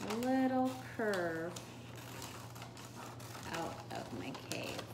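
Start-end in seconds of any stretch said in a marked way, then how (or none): none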